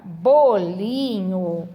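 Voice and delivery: "bolinho" drawn out slowly, each syllable apart; "bolinho" said very slowly, syllables separated. Shown as a demonstration. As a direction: spell out "bolinho" for kids, slow and clear. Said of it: "a sílaba do meio da palavra".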